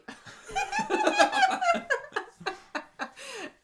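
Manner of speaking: high, long burst of laughter; loud laughter. Real, not acted. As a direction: crack up laughing. Laughing at robot request.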